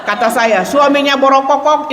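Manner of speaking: usual speaking voice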